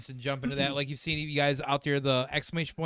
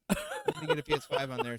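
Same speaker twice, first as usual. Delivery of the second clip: mock excited; 3 dB quieter, faster than usual. Teasing about wish list.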